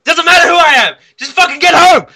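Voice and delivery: hammed-up high, full-volume shouting; random yelly man. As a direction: yell orders frantically into the phone. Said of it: "Who's the random yelly man?".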